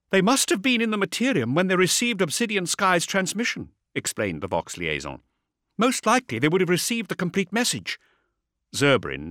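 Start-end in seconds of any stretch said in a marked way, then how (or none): 0.1-3.7 brisk, higher female officer; vox officer voice. A narrator's reading. 5.8-8.0 brisk, higher female officer; vox officer voice. A narrator's reading.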